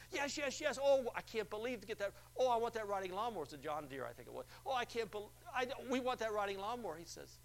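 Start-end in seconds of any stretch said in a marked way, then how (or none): 0.1-3.4 high, breathless yes yes yes; eager caller. Retold or imitated. Acted excited caller. 4.7-7.0 high, breathless yes yes yes; eager caller. Retold or imitated. Acted excited caller.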